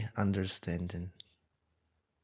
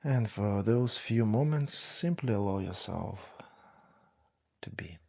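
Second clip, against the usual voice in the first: guided meditation. Real, not acted. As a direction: ease them gently into stillness, unhurried. Leads a breathing meditation.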